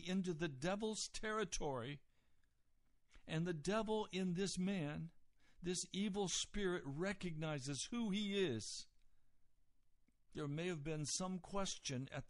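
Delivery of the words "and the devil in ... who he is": ordinary talk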